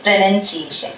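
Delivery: "pronunciation" with short "nun" in the middle; correct pronunciation. Shown as a demonstration. Teacher repeating the correction, "pronunciation" with short "nun". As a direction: say "pronunciation" without any "noun" sound. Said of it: "it is pronunciation".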